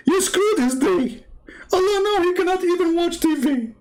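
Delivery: hammed-up panic, begging the room to stop yawning; mock distressed believer.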